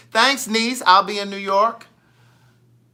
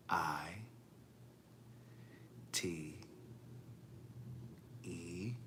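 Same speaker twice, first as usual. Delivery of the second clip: low, soft, one letter at a time; very slow spelling; 11 semitones lower, 24 dB quieter, much slower than usual. Shown as a demonstration. Host spelling her Cash App for Stephanie, very slowly. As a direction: leave a long pause after each letter, hushed and deep. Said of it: "I'm gonna talk very slowly".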